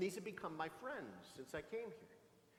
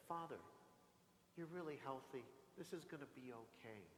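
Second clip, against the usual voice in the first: much softer, gently soothing the patient; gentle reassuring nurse; 13 dB quieter than usual. Retold or imitated. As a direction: hush it down, calm a scared patient. Nurse calms nervous priest.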